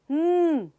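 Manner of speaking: long hummed "ਹਮ" as a pure nnn; sustained "ਹਮ" hum; N sound. Shown as a demonstration. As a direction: hold "ਹਮ" as an N with no vowel. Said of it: "next sound is".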